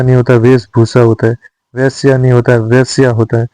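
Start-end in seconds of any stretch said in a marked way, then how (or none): none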